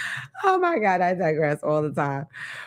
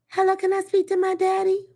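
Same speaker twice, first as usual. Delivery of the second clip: put-on high little kid on the phone; a kid calling daddy's mistress; child's voice; 12 semitones higher than usual. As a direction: ask sweetly like a small child.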